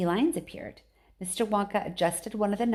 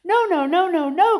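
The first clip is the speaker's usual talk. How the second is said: high, loud frantic no; crying out; 10 semitones higher, 10 dB louder than usual. A narrator's reading. Mister Wonka cries out to stop Mike, frantic. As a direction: cry out to stop him. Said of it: "cried Mister Wonka".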